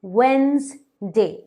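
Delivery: वेडनेसडे said slowly as "wenz-day", the middle d silent; "वेडनेसडे" drawn out as "wenz-day" for her viewers; correct Wednesday. Shown as a demonstration. Teacher slowly models "वेडनेसडे" as "wenz-day". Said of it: "इसे हम वेडनेसडे नहीं कहते हैं".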